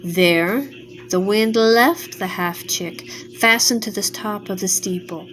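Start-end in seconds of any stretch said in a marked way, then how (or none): none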